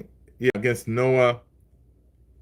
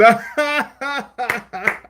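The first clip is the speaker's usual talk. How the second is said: high, long cackling laugh; real big laugh; 10 semitones higher than usual. Real, not acted. Streamer reacts to a viewer's pun, laughing hard.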